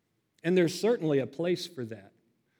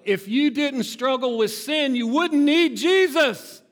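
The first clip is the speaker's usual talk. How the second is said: much higher, louder, slowed shout; preaching climax; 9 semitones higher, 8 dB louder, slower than usual. Acted. Pastor shouting the key point to the congregation, slow and loud. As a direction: drive the point home, full voice.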